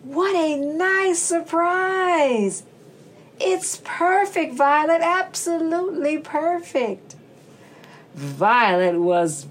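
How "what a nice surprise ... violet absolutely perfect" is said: delighted mother voice. Acted.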